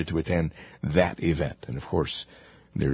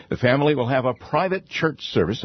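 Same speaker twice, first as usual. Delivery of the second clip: private said higher and leaned on; stress on private; 6 semitones higher than usual. Shown as a demonstration. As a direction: hit the word private hard. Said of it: "I emphasize the word private".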